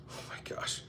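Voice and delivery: breathless whispered oh my gosh; whispered aside. Real, not acted. Streamer admitting to cheese, whispered aside. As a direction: drop to a whisper mid-sentence.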